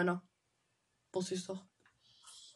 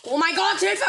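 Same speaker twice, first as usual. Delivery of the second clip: panicked; 5 semitones higher, 7 dB louder than usual. Real, not acted. Panics when an enemy attacks.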